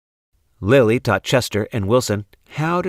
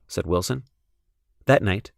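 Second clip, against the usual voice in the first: ordinary delivery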